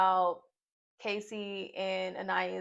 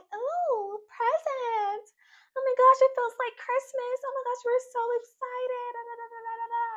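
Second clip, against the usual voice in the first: much higher, squealing mock excitement; mock giddy girls; 4 dB louder than usual. Vlogger imitating the girls excited over a gift box, high squeal. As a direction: squeal like kids at Christmas. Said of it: "They're like".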